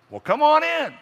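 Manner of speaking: high little grandma voice calling out; old grandma voice. Acted. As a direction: call him in like a loving grandma. Grandma's voice, high far timbre.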